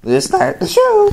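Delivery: very high cartoon bird voice; squeaky high cartoon woodpecker; Woody Woodpecker voice. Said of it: "impression of Woody Woodpecker".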